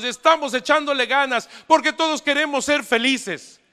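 loud, high, fervent preaching; preaching at full force. Acted. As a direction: drive the message home to the whole room. Preaches to the congregation.

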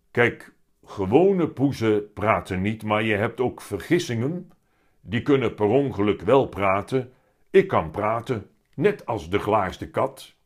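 loud, weighty cat voice; heavy-voiced talking cat. A narrator's reading. The cat explains himself to Willem, loud heavy voice. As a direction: pronounce it grandly as a big cat. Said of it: "met zware stem".

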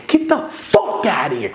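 voiced, strained retold command; angry dismissal. Man throwing his rival out, strained angry dismissal. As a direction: order him away, pitch pushed up.

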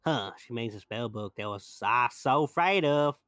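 put-on Southern accent. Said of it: "She's Southern".

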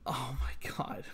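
soft breathless whisper; whispered disbelief. Real, not acted. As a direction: whisper it, defeated. Disbelief at twist.

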